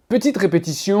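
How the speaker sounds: usual speaking voice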